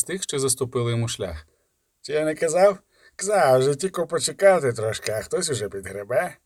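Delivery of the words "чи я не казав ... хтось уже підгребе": a hint of a rough thug's voice, mocking; lightly voiced robber. A narrator's reading. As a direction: read it as a sneering highway robber. Robber voice in audiobook.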